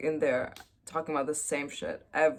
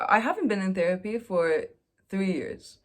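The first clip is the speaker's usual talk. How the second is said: ordinary delivery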